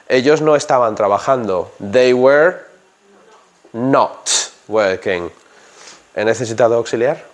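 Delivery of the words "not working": NOT drawn out slowly and hit hard; stress on not. Shown as a demonstration. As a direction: lean long on not. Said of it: "not, not, not". (acento en NOT, lento)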